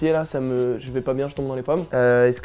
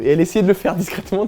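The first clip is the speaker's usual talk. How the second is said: ordinary delivery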